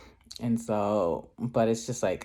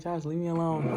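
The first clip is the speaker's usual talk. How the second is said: higher-pitched retold plea to a teasing coworker; mock protest; 6 semitones higher than usual. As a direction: playfully fend off the teasing, pitched up. Retells teasing exchange with coworker.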